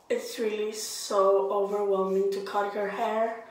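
talking through a face mask; inside a rubber mask. Real, not acted. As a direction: speak with a mask on your face. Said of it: "It's getting really hot in the mask".

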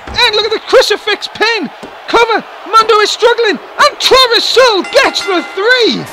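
high, loud climactic pin call; wrestling commentator. Acted. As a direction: build to the three count. Pinfall finish call.

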